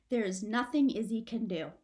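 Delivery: ordinary delivery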